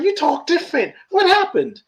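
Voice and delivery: high-pitched, acted-out amazement; astonished questioning. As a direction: react with surprise, pitched far up. Acting Michael noticing new accent.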